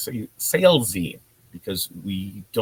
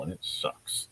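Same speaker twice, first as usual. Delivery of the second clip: muttered aside; 4 semitones lower, 11 dB quieter, slower than usual. Real, not acted. Muttered aside about posting cycle.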